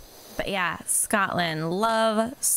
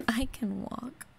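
whispered; 4 semitones higher, 5 dB quieter than usual. Real, not acted. Creeping on the roof.

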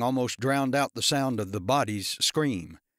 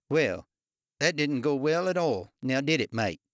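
put-on Australian accent; 3 semitones higher than usual. A narrator's reading. Darren speaks in Australian accent.

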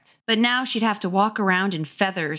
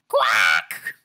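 harsh shouted quack; rasping duck cry; duck impression; 9 semitones lower than usual. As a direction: squawk like a duck. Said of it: "my impression of a duck".

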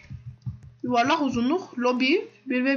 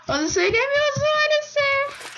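high sing-song; 12 semitones higher than usual. Pretend. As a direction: stretch maalesef up high. Repeats line, sing-song high.

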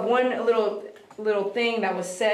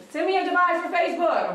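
mock plea; 7 semitones higher, faster than usual. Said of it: "I'm just kidding".

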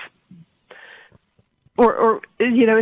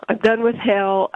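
ordinary delivery

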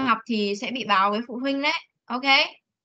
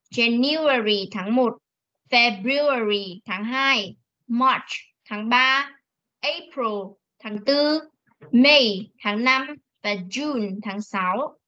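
slow model reading; slower than usual. Shown as a demonstration. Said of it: "teacher reads months for class".

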